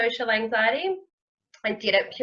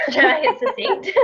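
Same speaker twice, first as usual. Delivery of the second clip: higher, laughing through the words; 13 semitones higher, 4 dB louder than usual. Real, not acted. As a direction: say it while breaking into laughter. Laughs at promise of short story.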